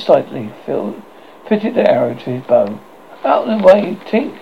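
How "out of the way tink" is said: mild, higher-pitched shout. A narrator's reading. Tootles orders Tink aside before firing, shouting. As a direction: call out a warning, a little raised. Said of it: "he shouted".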